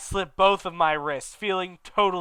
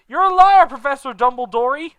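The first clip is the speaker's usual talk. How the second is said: much higher and louder accusing yell; angry shout; 11 semitones higher, 13 dB louder than usual. Acted. Hagrid shrieking at Dumbledore while storming to bed. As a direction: hurl the accusation as you storm off.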